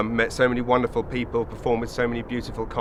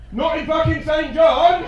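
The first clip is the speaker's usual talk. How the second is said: sudden high shouted punchline; comic shout; 14 semitones higher, 5 dB louder than usual. Real, not acted. Comedian shouting to the Saint John's crowd, high and loud. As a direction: belt it out to the crowd.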